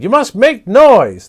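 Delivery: high, loud urgent shout; rally everyone with a yell. Acted. Papagayo urging the creatures, loud high shout. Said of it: "he shouted".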